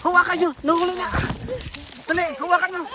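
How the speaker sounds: high frantic cries; panicked shouting. Real, not acted. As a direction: yell to grab hold. Friend falling, panic. (takot na sigaw)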